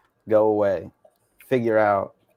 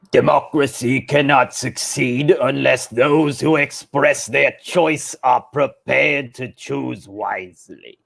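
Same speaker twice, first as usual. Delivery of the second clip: slow, whispery villain impression; Palpatine; slower than usual. Co-host re-voices the quote on request as a villain. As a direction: draw it out in a sinister put-on voice. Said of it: "Palpatine it".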